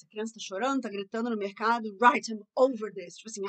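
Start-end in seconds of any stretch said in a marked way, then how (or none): 2.0-3.1 loud, drawn-out exasperation; fed-up parent. Shown as a demonstration. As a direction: snap loudly, out of patience. (impaciência em voz alta)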